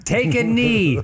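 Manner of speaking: cold rasping evil emperor. Acted. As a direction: bark the order like a warlord. War chief commands.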